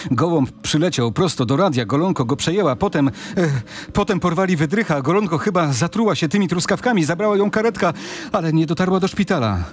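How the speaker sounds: words pouring out in a frightened flood; panicked babble. Acted. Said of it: "urywane, chaotyczne, pełne paniki".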